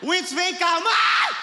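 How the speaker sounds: very high loud mock mom call; hammed-up mother shouting come here; mom yelling. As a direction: summon the kid with a yell. Mother calling kid to be spanked.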